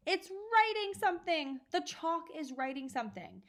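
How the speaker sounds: high excited scream. A narrator's reading. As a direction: shriek it out in alarm. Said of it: "Nigel had screamed".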